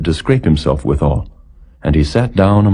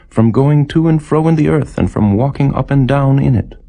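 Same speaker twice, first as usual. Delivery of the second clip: higher, sly voice of Satan; Satan's answer; 8 semitones higher than usual. Acted. Satan answers the Lord, higher and sly. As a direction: lift the voice into a light, sly adversary.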